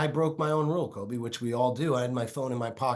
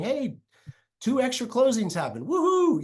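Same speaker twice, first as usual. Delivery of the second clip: higher and louder, cheering woohoo; celebration; 11 semitones higher, 5 dB louder than usual. Retold or imitated. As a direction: cheer like the deal closed. Mock celebration on good news.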